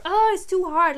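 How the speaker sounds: high, loud hammed-up complaint; mock whining. Acting out being put off by technical things, whining. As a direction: whine it high and loud, giving up. Voicing someone giving up.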